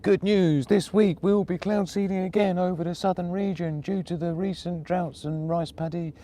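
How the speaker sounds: imitating a cheery official news bulletin, pitched high; mock official announcement. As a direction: parody a government bulletin. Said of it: "it would be like".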